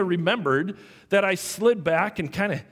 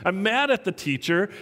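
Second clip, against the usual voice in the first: ordinary talk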